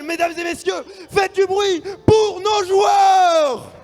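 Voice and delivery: very high, loud, slow hype call; crowd hype shout. Acted. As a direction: get the whole hall roaring. Calls the crowd to cheer.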